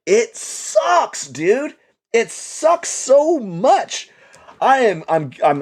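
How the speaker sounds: high, slow drawn-out whine; whiny complaint. Real, not acted. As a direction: stretch it out in misery.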